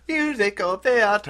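high, drawn-out stage croon; slow, loud old-fashioned show voice; old-timey musical theater. Acted. Telling a co-host about the old show, hammy theatrical. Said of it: "more old-timey, like".

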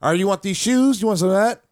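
loud, high, demanding mom; stern mom voice. Retold or imitated. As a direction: bark it like a tough single mom. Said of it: "So she would, like".

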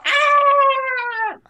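loud, very high scream; mock scream. Tourist under attack in an improv scene, high scream.

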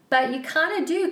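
normal talking voice

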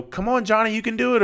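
high-pitched mock parent cheering on a kid. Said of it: "they get in there and they're like".

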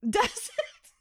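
high, startled little cry; surprised. Real, not acted. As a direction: caught off guard, voice jumps up. Shocked by gun remark.

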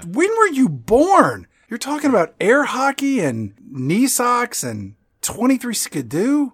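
high, swooping disbelief; mock incredulous. Teasing co-host over old-timey word, high mock disbelief. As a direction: exclaim it with drawn-out, hammed-up incredulity.

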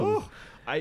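high Irish trill on the oh. Pretend. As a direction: roll into a mock Irish trill. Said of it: "did you see, hear that trill?".

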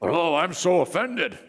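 sarcastic, hammed-up offended sigh; mock offense. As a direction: mimic a fake outrage. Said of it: "It's all show".

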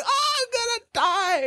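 acted-out scream of delight without much volume; fangirl squeal. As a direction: squeal it like a starstruck fan, voice way up. Acts out fangirl thrill at concert.

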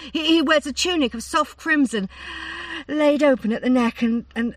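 lovestruck gushing. A narrator's reading. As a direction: gush over him like a smitten girl. Daughter swooning over handsome rider.

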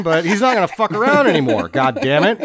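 ordinary talk, nothing marked

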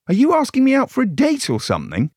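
sudden high mock astonishment; mock surprise. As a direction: leap up high in mock disbelief. Witch mocking constable.

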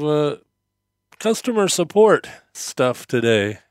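usual speaking voice

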